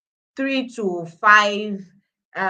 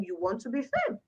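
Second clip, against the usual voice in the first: ordinary delivery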